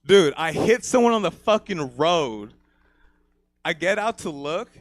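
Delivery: usual speaking voice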